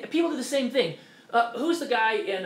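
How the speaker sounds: ordinary delivery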